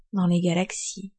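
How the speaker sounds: ordinary talk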